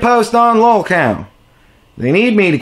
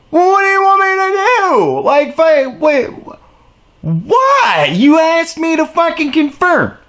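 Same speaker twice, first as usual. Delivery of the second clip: high, incredulous mock protest; acted exasperation, high and stammering; exasperated retort; 9 semitones higher than usual. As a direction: replay your baffled comeback, pitched up. Retelling exasperated reply to forum.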